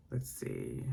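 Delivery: breathy whispered aside. Real, not acted. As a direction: whisper it to yourself. Aside while finding place.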